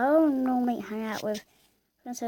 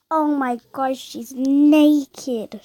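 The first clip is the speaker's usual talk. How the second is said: loud scandalized cry; much louder mock horror; shocked outburst; 9 dB louder, slower than usual. Real, not acted. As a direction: gasp it out in shock. Shocked at avatar.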